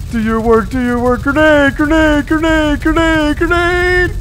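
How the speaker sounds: high, loud chanted urging; grenade warning yell. Real, not acted. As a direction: keep yelling grenade, rising. Urging towers on.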